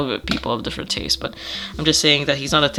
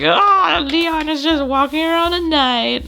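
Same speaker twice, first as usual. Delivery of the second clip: high, lilting storybook voice; high-pitched playful scene narration; narrating character; 13 semitones higher, 3 dB louder than usual. Retold or imitated. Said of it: "I just, like".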